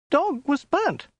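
very high falsetto woman's voice; frightened woman. A narrator's reading. Captive woman telling Khan her day, frightened falsetto.

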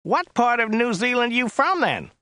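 high, whiny chauffeur; Joe's whiny voice. A narrator's reading. Driver describing the client to his passenger, high and whiny. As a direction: pitch it up into a whine. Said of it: "His voice was rather high and whiny".

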